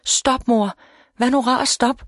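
high, understated desperate plea; a child pleading desperately with mother. A narrator's reading. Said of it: "hulkede Amy desperat".

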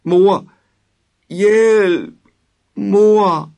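very high, loud cries for parents; screaming for help. A narrator's reading. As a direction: scream for mum and dad in terror. (en bange dreng skriger højt efter sine forældre)

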